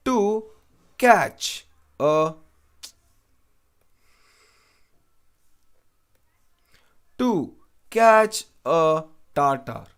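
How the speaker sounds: slow, drawn-out reading of the idiom. Shown as a demonstration. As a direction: read each word slowly for the class. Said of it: "teacher reads idiom aloud slowly".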